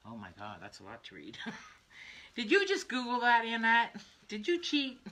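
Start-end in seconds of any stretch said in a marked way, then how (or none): 2.4-5.0 high-pitched, laughing mock accusation; playful accusation. Real, not acted. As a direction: tease her as if you caught her cheating. Teasing a viewer for looking it up.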